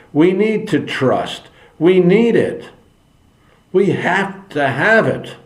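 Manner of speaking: rising fervent preacher; impassioned preaching. Acted. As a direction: preach it like a pulpit plea. Preaching rise about honesty.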